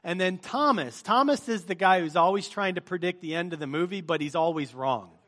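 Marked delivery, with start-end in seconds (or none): none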